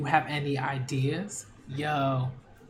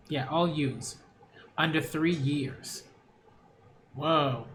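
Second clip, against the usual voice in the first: ordinary delivery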